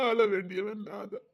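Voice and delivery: ordinary talk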